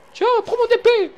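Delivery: very high squeaky cartoon voice; Mickey voice. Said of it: "J'ai un peu la voix de Mickey aussi".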